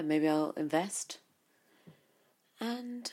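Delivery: usual speaking voice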